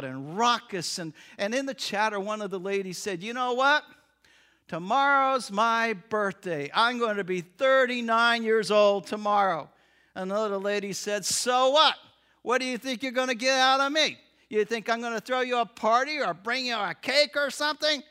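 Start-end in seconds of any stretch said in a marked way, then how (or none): 3.2-9.7 loud, boisterous announcement; brash barroom brag; loud raucous lady. Acted. 11.2-18.0 loud, mocking put-down; brash sarcastic jab, louder. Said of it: "why do you have to be so sarcastic".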